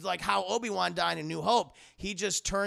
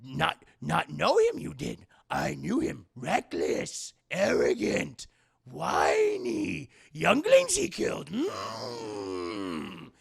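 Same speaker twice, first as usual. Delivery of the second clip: Yoda voice; 4 dB louder, much slower than usual. Retold or imitated. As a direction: build from grumble to rant. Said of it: "And Yoda's like".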